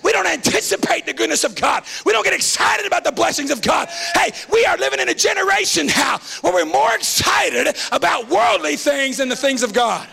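full-force Southern revival preaching; rallying shouted sermon with drawn-out key words; fiery preaching. Real, not acted.

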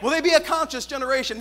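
fiery preacher building to a shout; preaching. Acted. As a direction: preach it with rising fire. Preaching at full force.

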